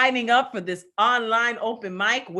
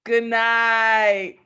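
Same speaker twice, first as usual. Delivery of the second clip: shouted farewell; 4 semitones higher, 6 dB louder than usual. Real, not acted. Host calling farewell to audience.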